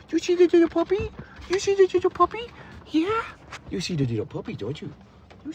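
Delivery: high, sing-song puppy talk. Real, not acted. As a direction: coo at the dog in a high voice. Baby talk to the dog.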